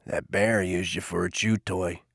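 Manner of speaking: low and subdued, quiet concern; Garvey voice. A narrator's reading.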